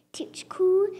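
breathy blow over the hot bite; blown whisper. Acted. Child blowing on hot potato, whispering. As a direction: whisper it while blowing on the fork.

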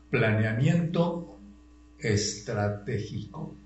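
slow, measured term for note-takers; teacher slowly dictating a key term; slow dictation. Real, not acted. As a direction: spell out the term slowly, chunk by chunk.

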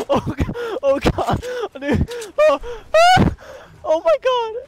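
high, loud wailing gasps; breaking up, pitch leaping high; overwhelmed crying. Real, not acted. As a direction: break into overwhelmed cries between words. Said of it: "Oh, I'm crying, dude".

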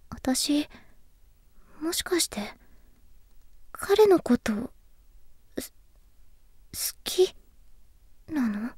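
very soft, halting self-question; hesitant realisation. Acted. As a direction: barely dare to ask it. Hesitant realisation she may like him.

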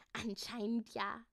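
usual speaking voice

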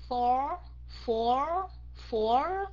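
"for" drawn out into the R; held R ending. Shown as a demonstration. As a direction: stretch each "for" right through its end. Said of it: "phải kéo dài phía sau".

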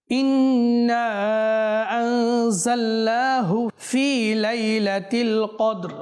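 slow melodic chant with drawn-out vowels; slow Quran recitation. Shown as a demonstration. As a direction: recite the verse slowly in tajweed. (ধীর সুরেলা তিলাওয়াত)

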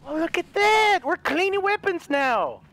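thrilled gamer exclamation; excited. Real, not acted. Delighted at new game feature.

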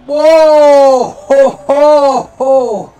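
loud startled whoa and ho ho ho; real startled yelp. Real, not acted. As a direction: yelp as the chair wobbles under you. Chair nearly tips over.

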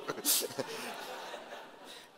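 short unvoiced chuckle; breath-heavy laugh after teasing the crowd. Real, not acted. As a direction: chuckle at your own joke. Laughs after brainwash joke.

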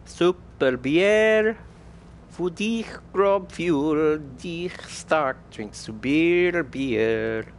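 high, drawn-out mock German slogan. Player hawking super beer, hammed-up German accent. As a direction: belt out a hammed-up German slogan, stretching "Bier".

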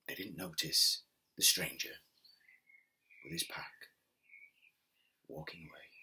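gentle storyteller reading a folk tale; story narration. A narrator's reading. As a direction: tell it like a bedtime story.

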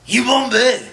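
high, loud hammed-up Chinese-African accent; mixed Chinese accent. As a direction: ham up a clashing mashup of accents.

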